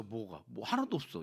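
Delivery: ordinary talk, nothing marked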